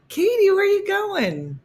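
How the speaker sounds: very high, squeaky voiced-out question; high-pitched mock-eager asking; acted excited question. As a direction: read the question way up high, loudly. Reads a viewer's question aloud.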